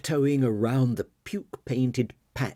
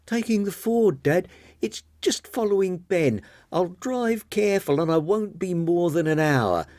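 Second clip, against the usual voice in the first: put-on young woman voice; 8 semitones higher than usual. Marian leaving, talking to Dad.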